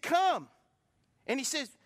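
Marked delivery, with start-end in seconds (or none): 0.0-0.5 calling come. Retold or imitated. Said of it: "he said".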